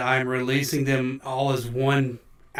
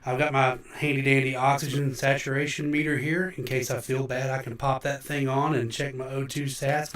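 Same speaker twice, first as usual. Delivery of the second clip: usual speaking voice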